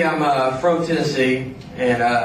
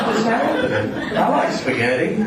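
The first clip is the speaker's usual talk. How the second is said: rising, hopeful small talk; mock-timid request to join; awkward newcomer; 6 semitones higher than usual. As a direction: pitch it up like an eager outsider. Awkwardly asking to join the mafia.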